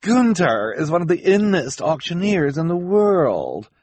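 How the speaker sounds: high, emphatic impatient retort. Acted. Esme defends Günther to the children, impatient. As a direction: cut in impatiently, pitched high. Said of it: "Esme said impatiently".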